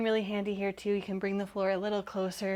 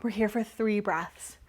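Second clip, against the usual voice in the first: ordinary delivery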